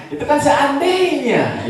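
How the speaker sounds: put-on high, slow old grandma voice; grandma's voice. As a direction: drawl it out like the innocent oma. Oma explains it was hypothetical. (suara nenek tinggi dan lambat)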